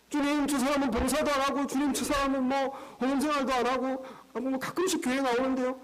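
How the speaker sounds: high, pleading complaint about others; whiny disciple. Acted. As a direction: tattle on the others to the Lord, high and eager.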